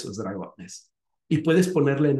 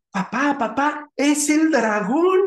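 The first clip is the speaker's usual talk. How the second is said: put-on high, eager little boy's voice; a kid urgently telling his dad; high child calling out; excited child; 11 semitones higher, 3 dB louder than usual. Child calls out to father.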